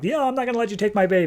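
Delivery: high, rapid acted refusal; defiant refusal. Zola refuses to hand over her baby, defiant.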